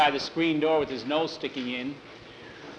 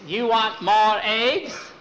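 high, loud mock Chinese accent; hammed-up Chinese cook's pidgin accent; 7 semitones higher, 6 dB louder, slower than usual. Ranch cook offering more eggs, in mock Chinese accent. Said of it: "Chinky says".

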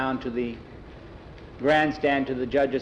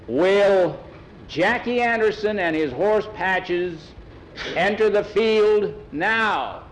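loud, slow, high public announcement; drawn-out official call to the field; announcer calling; 7 semitones higher, 4 dB louder, slower than usual. Acted. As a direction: stretch it out across a race ground. Judges make public announcement.

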